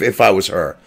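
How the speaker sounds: ordinary talk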